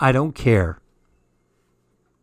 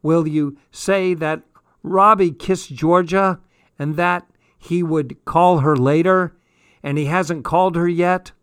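loud, higher shout up the stairs; raised, carrying voice; yelling back; 4 semitones higher, 5 dB louder than usual. A narrator's reading. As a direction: yell a long message to someone upstairs. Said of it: "I heard Jazz yell back".

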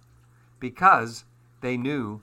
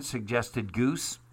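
ordinary delivery, nothing marked

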